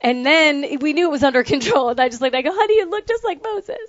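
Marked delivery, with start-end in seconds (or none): 2.5-3.8 high, playful joke to her husband; teasing quip. Retold or imitated. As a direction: deliver the joke brightly. Joking remark to husband.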